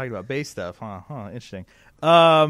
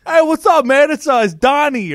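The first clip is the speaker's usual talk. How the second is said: higher, louder, chummy New York guy voice; imitating the rep's voice; 10 semitones higher, 11 dB louder than usual. Salesman calling a customer, higher louder guy voice. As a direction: play the pushy sales rep, up and loud. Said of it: "You do that voice perfectly".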